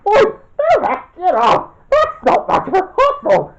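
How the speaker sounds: high-pitched yelps, half laughing; squealing, laughing protest; playful protest. Pretend. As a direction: shout them off you in a mock struggle. Being jostled, protesting through laughter.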